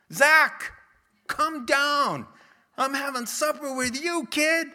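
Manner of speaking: loud, high call up a tree. Retold or imitated. Jesus calling Zacchaeus down from the tree, loud. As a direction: shout up to him, friendly.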